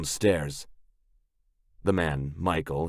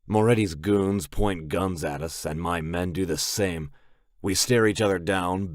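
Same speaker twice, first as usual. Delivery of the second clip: usual speaking voice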